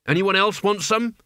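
high, aggressive challenge; snarled challenge. A narrator's reading. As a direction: throw it out as a dare to fight. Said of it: "he snarled".

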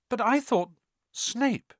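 high, breathless disbelief; can't believe it, voice jumps up; stunned disbelief. A narrator's reading. Harry stunned.

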